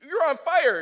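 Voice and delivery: high, loud, eager; fired up and ready; pumped up. Retold or imitated. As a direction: Moses eager to go. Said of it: "You'd think he's like".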